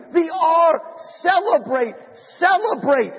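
high-pitched wail of the distraught monk; screaming out. Acted. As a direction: wail it high, in comic anguish. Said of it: "Brother Andrew screams out".

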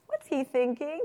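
exaggerated curiosity; teacher's prompting question. Shown as a demonstration. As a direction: wonder aloud for the class. Demonstrating question beside a character.

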